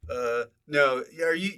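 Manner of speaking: normal talking voice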